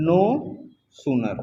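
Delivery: "no" and "sooner" each said alone with a long pause between; words set apart. Shown as a demonstration. Teacher picks out the phrase for students word by word. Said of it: "इसमें लिखा गया है no sooner".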